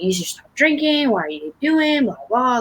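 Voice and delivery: imitated attack, high and insistent. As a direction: nag accusingly, pitched well up. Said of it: "a lot less attacking".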